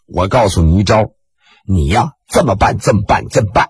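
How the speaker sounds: low, sly adviser voice; sly advisor. Acted. Ji Xiaolan coaxing his friend, low and sly. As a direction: lean in low and knowing.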